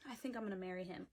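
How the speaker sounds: normal talking voice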